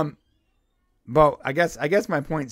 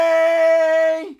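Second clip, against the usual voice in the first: high, loud drawn-out call; shouted announcement; 13 semitones higher, 10 dB louder than usual. Pretend. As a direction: belt out one long exclamation. Abrupt loud topic-change call.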